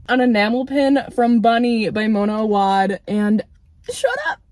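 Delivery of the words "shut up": very high breathy "shut up!" gasp; excited squeal. Real, not acted. Finding an enamel pin, a high squeak. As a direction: squeak it out in disbelief. Another surprise gift.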